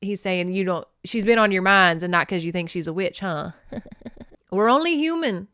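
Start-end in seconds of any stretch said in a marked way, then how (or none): 4.5-5.5 Scottish clansman. A narrator's reading. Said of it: "said Neil".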